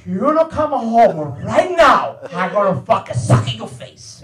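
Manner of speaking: loud, drawn-out yell, then slow threat; mad Italian father. Acted. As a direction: bellow for your son like a furious dad. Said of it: "my father gets mad".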